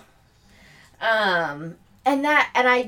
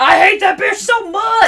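hammed-up high yell of hate; loud, high mock rant; mock shouting husband; 10 semitones higher, 7 dB louder than usual. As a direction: yell it like a cartoon villain.

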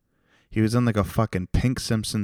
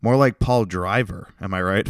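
ordinary talk

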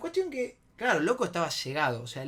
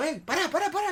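high, frantic interruption; retold alarmed shout; urgent stopping; 12 semitones higher, 4 dB louder than usual. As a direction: break up a fight with repeated calls. Stopping a friend's fight.

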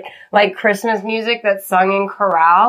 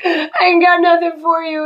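put-on tearful kid voice; 8 semitones higher than usual. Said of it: "he's like".

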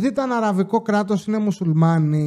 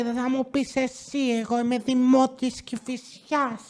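higher, softer put-on complainer voice; crazy local woman; 5 semitones higher, 6 dB quieter than usual. Crazy local pulls rank on the attendant, mock-indignant high voice. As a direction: mimic an indignant resident, high and drawn out. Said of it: "έρχεται μία τρελή εκεί πέρα".

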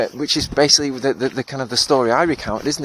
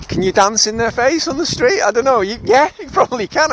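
asked through real laughter, pitched up; laughing; 10 semitones higher than usual. Real, not acted. Joking about dancing in an angry stranger's face, laughing. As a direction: giggle through the question.

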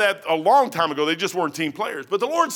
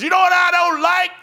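high, loud retold yell at a soldier; small guy shouting up at the officer, high and loud; 10 semitones higher, 6 dB louder than usual. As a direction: bark it up in his face. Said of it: "started yelling".

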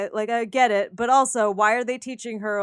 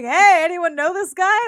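higher and louder, calling to a crowd; mock calling out; 7 semitones higher, 8 dB louder than usual. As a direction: shout it like hauling him around asking strangers.

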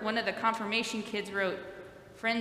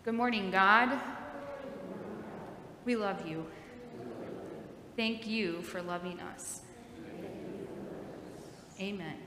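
soft slow call-and-response prayer; slow prayer for kids to repeat; 3 semitones lower, 7 dB quieter than usual. Shown as a demonstration. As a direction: lead the children in prayer. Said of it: "repeat after me".

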